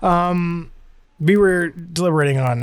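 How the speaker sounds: usual speaking voice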